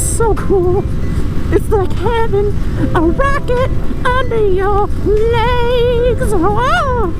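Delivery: excited. Real, not acted. Thrilled describing fast bike.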